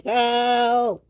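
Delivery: long, high wailing call; acted distress scream; screamed for help. A narrator's reading. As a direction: stretch out a high cry for rescue. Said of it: "he screamed".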